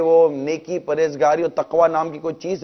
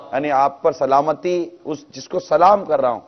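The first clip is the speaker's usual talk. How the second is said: ordinary delivery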